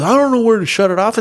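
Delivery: fast, high imitation of a panicked friend; flustered. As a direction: act out a helpless, hurried protest. Flustered friend answers, retold.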